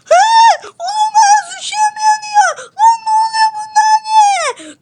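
yüksek sesle panikleyen Ahmet; uçağı yanıyor, çaresizce bağır; panik. Acted. Ahmet uçağının yandığını görüyor. (uçağı yanan Ahmet, panik içinde bağırıyor)

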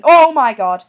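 loud panicked oh my God; frightened shout. Real, not acted. Angered zombie at the door, loud alarmed shout. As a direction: yell it in alarm.

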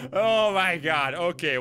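usual speaking voice

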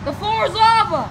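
called out. Pretend. Calling out the game.

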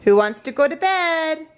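high, lilting mock-enticing question; playful baby talk. Parent asking baby about bedtime, playful baby talk. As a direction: pitch it up for a baby.

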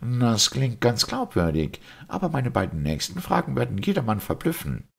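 high-pitched, confident doctor; challenging doctor. Acted. Doctor promising the priest two baffling questions, challenging. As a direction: pitch it high, ready to confound them.

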